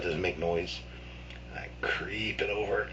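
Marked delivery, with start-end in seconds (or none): 1.5-2.9 slow, soft half-whisper; stealthy storyteller's hush; hushed creeping. Retold or imitated. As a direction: draw it out quietly, as if sneaking.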